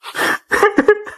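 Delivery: high, strangled laugh that breaks into a sob; a cracked, tearful half-laugh; choked sob-laugh. Real, not acted. Father filming a goodbye video, choked tearful laugh. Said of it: "I'm hurting so bad".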